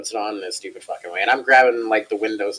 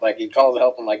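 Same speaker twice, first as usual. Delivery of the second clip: usual speaking voice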